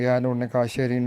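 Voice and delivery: usual speaking voice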